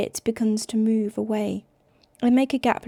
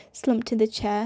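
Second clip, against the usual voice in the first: ordinary delivery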